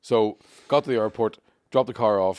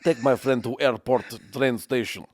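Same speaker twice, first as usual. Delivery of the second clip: slow, exaggerated Russian accent; thick Russian accent; slower than usual. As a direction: spell it out slowly, heavily Russian. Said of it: "The Russian girl was like".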